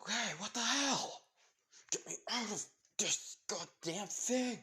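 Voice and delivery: high, strained, drawn-out yelling; furious villain. Acted. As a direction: struggle and holler to be let loose. Tied-up villain protests.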